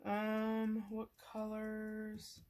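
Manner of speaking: talking through a yawn; words stretched by a yawn. Real, not acted. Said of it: "I am sorry for yawning".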